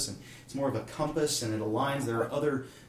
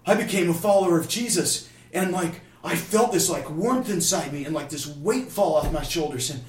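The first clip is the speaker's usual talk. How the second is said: acted-out elation, loud and raised; imitating someone bursting with amazing news; excited announcement; 5 semitones higher, 9 dB louder than usual. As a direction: gush to your friends like a thrilled convert. Retold new believer telling his friends.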